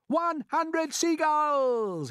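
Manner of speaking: high, loud, slow triumphant count; shouted. A narrator's reading. James shouting the tally while sweating. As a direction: ring it out to everyone. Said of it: "he shouted".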